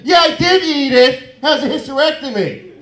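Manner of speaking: high, very loud defiant yell; angry shouted retort. Acted. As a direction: bellow back at the old lady. Acted angry retort to Edith.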